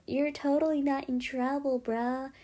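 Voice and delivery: mock vice principal. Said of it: "where the vice principal's like".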